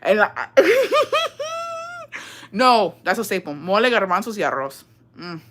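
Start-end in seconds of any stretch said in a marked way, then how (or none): 0.5-2.3 high-pitched laugh. Real, not acted. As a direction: let out a high giggle. Laughs between remarks.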